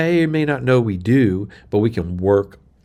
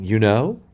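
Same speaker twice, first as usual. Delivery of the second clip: "You know?" rising at the end as if asking; pitched-up question ending. Shown as a demonstration. Preaching coach showing a distracting habit, "You know?" pitched up questioningly. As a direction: lift "know" upward like a question. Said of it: "You're just pitching your voice up".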